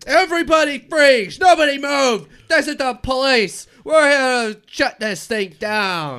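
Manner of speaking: loud, high commanding raid shout; police officer shouting. Acted. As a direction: bark orders at the crowd.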